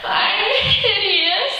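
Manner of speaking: put-on high, drawn-out childish wail; hammed-up child's squeal of horror; distraught seven-year-old. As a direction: wail it like a dramatic little kid. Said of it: "all I can say as a seven-year-old".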